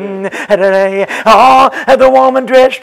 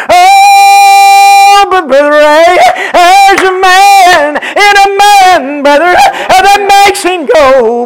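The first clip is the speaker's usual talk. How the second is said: higher, louder, slowed, sung-out chant; whooped preaching; 8 semitones higher, 6 dB louder, slower than usual. Acted. Preacher telling the sick brother that doctors can't see the real man, whooped. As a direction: ring out each phrase in a preacher's whoop.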